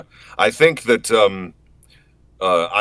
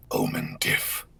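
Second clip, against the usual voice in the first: much lower, dark and far-off; Omen voice line; 12 semitones lower, 6 dB quieter, slower than usual. Acted. Answering chat's request, deep character voice. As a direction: drop into the shadowy character voice.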